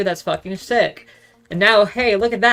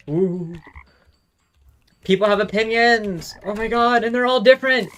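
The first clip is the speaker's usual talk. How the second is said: higher, slowed, faux-astonished sarcasm; sarcastic mock amazement; 6 semitones higher than usual. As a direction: act dazzled by something obvious.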